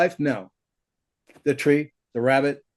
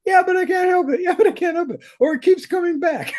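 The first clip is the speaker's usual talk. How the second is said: high-pitched mimicking of helpless students; whiny excuse. Teacher mimicking students who hang on to their stuff, high whiny excuse. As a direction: squeal the excuse in a mock falsetto. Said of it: "bullshit that we all hang on to".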